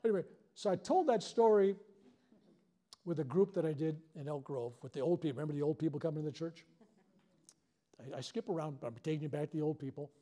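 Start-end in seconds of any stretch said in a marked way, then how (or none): none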